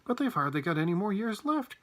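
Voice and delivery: mildly wailed protest; wailing. A narrator's reading. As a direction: lament with a touch of alarm. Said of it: "wailed Grandpa Joe".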